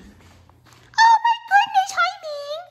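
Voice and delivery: very high cooing voice. Real, not acted. Greeting Ming the pet in a very high coo.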